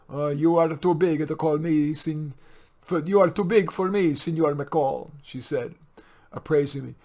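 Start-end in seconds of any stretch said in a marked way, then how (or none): 0.1-5.1 higher and louder, a bossy Italian housekeeper; Italian maid, louder. Acted. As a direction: scold him fondly like an Italian maid. Maria voiced.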